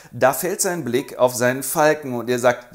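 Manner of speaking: normal talking voice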